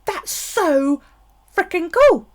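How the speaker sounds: high and loud, thrilled by the craft idea; marvelling at a clever craft hack, excited; excited gush. Retold or imitated. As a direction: gush with rising delight. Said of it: "and it was like".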